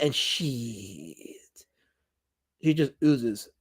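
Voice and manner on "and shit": and shit, whispered under the breath; a quick whispered throwaway; whispered aside. Real, not acted. Streamer whispers a cheeky add-on to a viewer's greeting.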